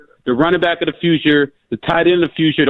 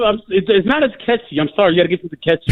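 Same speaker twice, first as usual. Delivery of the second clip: ordinary talk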